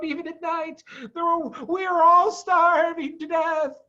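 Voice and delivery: high and hurried, near panic; excited, frightened Badger. A narrator's reading. Badger telling of the guns, excited alarm. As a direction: pour out the bad news. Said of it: "Badger said excitedly".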